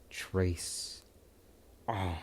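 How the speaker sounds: low, soft, nearly whispered; quiet whispered line. Retold or imitated. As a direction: breathe it like a cold threat. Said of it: "Midoriya just says".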